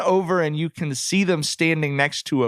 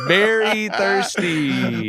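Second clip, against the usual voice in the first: slow, drawn-out admission; mock confession; 5 semitones higher, much slower than usual. Real, not acted. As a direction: stretch each word like a guilty plea. Admits he is desperate for passes.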